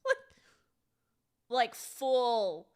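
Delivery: talking through pouted duck lips. Shown as a demonstration. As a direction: push the lips out in a big pout. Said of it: "Instagrammer influencer duck lips".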